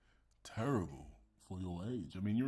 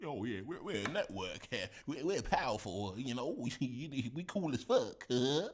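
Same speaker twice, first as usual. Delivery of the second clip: higher, puffed-up boasting voice, mockingly; 5 semitones higher than usual. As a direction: play a cocky streamer bragging to his crew. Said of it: "He's like".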